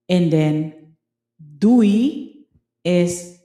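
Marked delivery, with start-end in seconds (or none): 1.4-2.4 doei drawn out with a rising tune, said like dui; Dutch doei. Shown as a demonstration. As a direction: sing out doei like a cheery goodbye. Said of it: "is bye".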